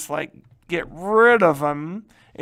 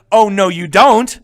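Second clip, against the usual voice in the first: defiant retort; 2 semitones higher, 11 dB louder than usual. Retold or imitated. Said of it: "liberals are like".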